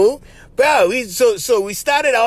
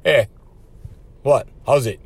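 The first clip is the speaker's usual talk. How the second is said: "Hey", long pause, "what?", then a low "How's it?"; "Hey... what? How's it?" in separate pieces, dropping low at the end; alert, challenging greeting; 5 semitones lower than usual. Shown as a demonstration. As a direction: put a pointed "what?" inside the greeting. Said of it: "that's the alert".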